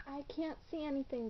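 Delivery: normal talking voice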